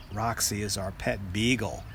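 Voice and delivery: ordinary delivery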